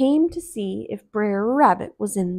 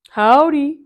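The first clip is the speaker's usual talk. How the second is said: calling back; 6 semitones higher, 9 dB louder than usual. Acted. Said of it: "called Brer Bear".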